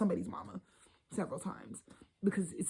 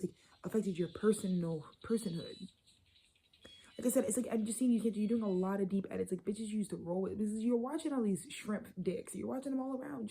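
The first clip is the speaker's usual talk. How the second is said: ordinary talk, nothing marked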